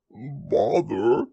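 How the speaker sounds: lightly voiced bear, stuck and bothered; Pooh inside a jar. A narrator's reading. Pooh grumbles with his head in a honey jar, mildly bothered. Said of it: "he said inside the jar".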